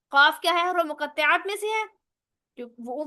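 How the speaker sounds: normal talking voice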